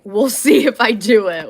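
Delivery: laughing. Real, not acted. Vlogger laughing about putting off one call. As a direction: laugh at your own doubt.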